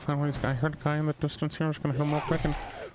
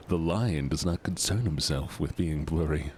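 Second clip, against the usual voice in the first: low, solemn lion proclamation; grave deep narrator voice; 9 semitones lower than usual. Pretend. Lion meme about friend.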